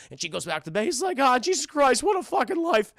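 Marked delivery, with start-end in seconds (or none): 1.2-2.9 high, loud cartoon lament; exasperated Courage impression. Dog fed up after the blame, exasperated. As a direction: despair in a raised voice.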